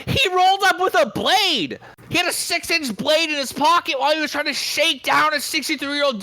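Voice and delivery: high-pitched, incredulous comic tirade; worked-up rant. Real, not acted. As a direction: get carried away, pitch up, punching key words. Hyped-up comic rant about rider.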